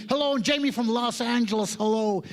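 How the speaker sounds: high, hammed-up American greeting; Jamie. Organizer comes up to the comedian, high mock American accent. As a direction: imitate an eager American introducing himself. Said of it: "Comes up to me".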